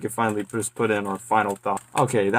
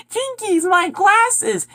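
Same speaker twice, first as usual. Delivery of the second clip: high, slow cartoon girl squeak; Velma; much slower than usual. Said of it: "she sounds nothing like Velma at all".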